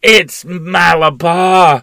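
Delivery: powerful strange scream. Acted. Boy on the rocking horse screaming at his mother, powerful and strange. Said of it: "he screamed in a powerful, strange voice".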